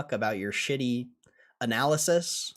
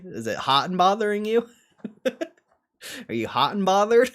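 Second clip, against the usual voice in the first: teasing; 10 semitones higher, 8 dB louder than usual. Acted. Teasing chat.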